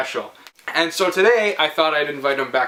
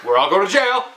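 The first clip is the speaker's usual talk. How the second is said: louder, rising mock dread; mock panic; 3 semitones higher, 4 dB louder than usual. Host retelling the traffic stop to viewers, hammed-up panic. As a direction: ham up the panic. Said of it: "We were like".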